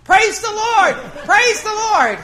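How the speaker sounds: high, loud jubilant shouts; captain shouting praise from the top deck. Retold or imitated. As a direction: bellow it from the deck, overjoyed. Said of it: "up on the top of the deck going".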